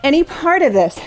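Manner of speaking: energetic live exercise cues; aerobics instructor. Acted. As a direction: drive them through the set. Cues exercise during interval.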